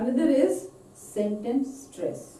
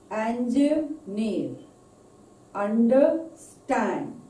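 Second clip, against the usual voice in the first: slow, syllable-by-syllable example words; very slow, deliberate word list with marked syllables; word stress demo; slower than usual. Shown as a demonstration. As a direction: draw out "Yesterday, property, statistic" to show the stress. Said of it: "where the proper stress is there".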